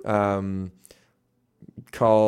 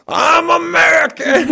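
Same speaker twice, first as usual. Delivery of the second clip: much higher, louder, slow brash shout; mock proud American; 8 dB louder, slower than usual. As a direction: bellow it like a chest-thumping patriot.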